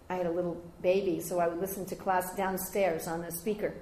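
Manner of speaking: ordinary delivery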